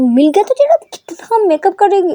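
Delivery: high, loud angry shout; mother shouts at her late daughter. A narrator's reading. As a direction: blast her over the makeup. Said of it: "shout करती है".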